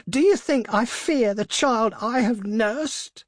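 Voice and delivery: high, slow, impassioned challenge; crying out. A narrator's reading. Mother scorning fear of her own child, crying out. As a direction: cry it defiantly, stretched and high. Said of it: "cried the old woman".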